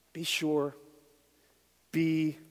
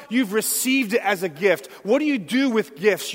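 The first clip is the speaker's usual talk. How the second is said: louder, higher, urgent pulpit voice; preaching with rising force; 7 semitones higher, 7 dB louder than usual. Acted. Pastor urging the church to be grateful, with rising preaching force. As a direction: drive the point home to the congregation.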